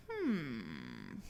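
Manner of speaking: low, quiet musing hum; soft pondering hmm; thoughtful hmm. Acted. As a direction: hum like a curious monkey thinking. George wonders about hibernating.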